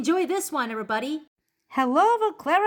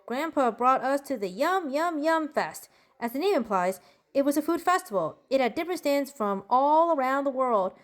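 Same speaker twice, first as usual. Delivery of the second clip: usual speaking voice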